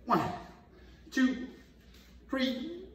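straining through push-ups; counting while doing dips; inverted push-ups. Real, not acted. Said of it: "let's go inverted, get a little dip action".

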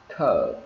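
drawn-out "Curb" dropping low, B swallowed; lips closed on final B. Shown as a demonstration. Teacher repeating the word for students, B held in. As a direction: say "Curb" long and falling, lips shut. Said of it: "chỉ cần ngậm môi là được".

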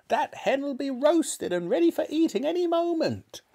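put-on very high, squawking old-woman holler; shouting. A narrator's reading. Grandma commenting on the burning hen, shouting. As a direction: holler it down in a piercing granny voice. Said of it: "Grandma shouted".

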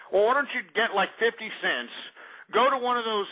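ordinary talk

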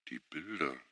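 murmured very softly, almost under the breath; a barely voiced murmur. A narrator's reading. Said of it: "murmelte Schuwan". (leise gemurmelt)

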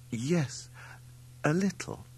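high, pleased little answer; delighted. A narrator's reading. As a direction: answer eagerly, glad to understand. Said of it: "delighted at having understood him".

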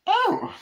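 high-pitched, loud "oh" of shock; mock shocked squeal; startled woman's yelp. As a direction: imitate a startled woman crying out. Startled woman in retold film scene.

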